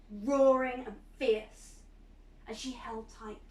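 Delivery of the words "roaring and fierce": loud, slow, roaring words; storyteller roaring out the lion; fierce roar. A narrator's reading. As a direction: let the lion roar through it. Lion transformation described.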